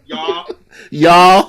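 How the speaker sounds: drawled American y'all, loud with a laugh. Pretend. Friends riffing on the accent, loud laughing American y'all. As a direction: stretch out y'all like a Southern American. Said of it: "your American accent".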